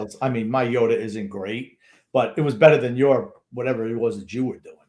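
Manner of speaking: usual speaking voice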